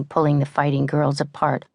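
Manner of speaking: usual speaking voice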